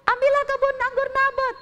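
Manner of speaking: announcing good news with glee; queen telling the king the vineyard is his; gleeful queen. Acted. Memberikan kabar gembira.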